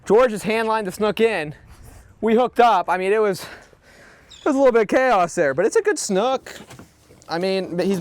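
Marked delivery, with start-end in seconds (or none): 0.0-6.5 buzzing after the catch; excited recap. Real, not acted.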